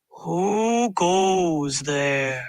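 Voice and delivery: very slow booming forest voice; deep echoing voice. Acted. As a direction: echo it from the dark. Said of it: "A deep voice echoed".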